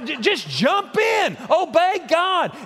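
urgent shout. Real, not acted. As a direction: push it out like a plea. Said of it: "preacher urging Jonah".

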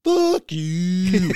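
put-on high, loud braying Canadian accent; donkey-like Canadian. Retelling a comedian's joke, braying Canadian accent. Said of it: "They sound like donkeys".

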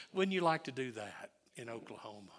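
ordinary talk

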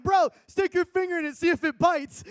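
high, gleeful teasing; cracking up. Retold or imitated. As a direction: egg him on in a giddy high pitch. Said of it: "I'm sitting there cracking".

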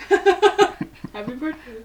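laughing through slow words; drawn out and laughing. Real, not acted. Laughing birthday wish.

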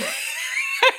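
high burst of laughter; laughing. Real, not acted. Laughs after own quote.

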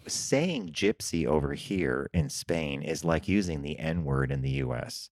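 quietly cautioning a friend, slow and hushed. Retold or imitated. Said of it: "Dirk says in a very quiet voice".

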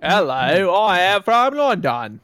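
slow, drawn-out attempt at a British accent; stretched-out hammy British vowels. Shown as a demonstration. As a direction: put on an exaggerated London accent. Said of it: "let's do a British accent".

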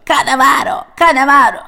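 high, loud parrot squawk of "Cannavaro". Acted. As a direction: screech the name like a parrot. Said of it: "bağırmaya başlıyor". (papağan gibi yüksek ve yüksek sesle "Cannavaro" diye cıyaklayarak)

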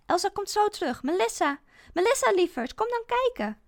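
put-on high, loud coaxing call to a child; calling to the phone. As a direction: lure the girl back to the screen. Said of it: "roep ik naar het scherm". (hoog en luid lokkend geroepen)

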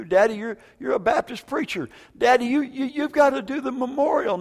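retold daughter's plea, high and halting; earnest plea. Daughter asks her preacher father to do the memorial, pleading.

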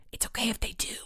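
secretive whisper to a friend; hushed, breath-only voice. A narrator's reading. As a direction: whisper it so nobody nearby hears. Friends hiding, whispering to each other.

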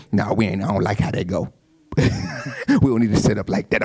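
low stubborn grumble with a laugh; contrary member. Retold or imitated. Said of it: "Somebody say".

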